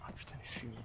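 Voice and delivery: whispered curse. Real, not acted. Cursing quietly under fire.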